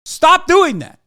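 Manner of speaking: mock stern scolding. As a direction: scold like a fed-up parent. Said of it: "the United States of America turned around and said".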